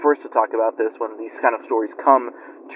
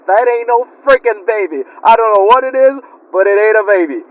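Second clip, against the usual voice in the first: higher and louder, freaked-out yell of the uncle; a terrified drunk refusing the thing in his arms; panicked drunk uncle; 7 semitones higher, 5 dB louder than usual. Retold or imitated. The uncle panics over the creature and throws it, high panicked voice. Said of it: "he said".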